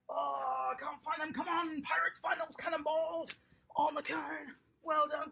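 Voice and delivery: normal talking voice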